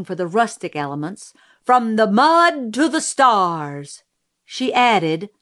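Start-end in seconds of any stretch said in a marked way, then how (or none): 1.6-4.0 slow, grand proclamation; declaiming. A narrator's reading. Mrs. Merriweather translating the motto for the audience, declaiming. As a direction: draw it out for the back row. Pageant speaker declaiming at lectern.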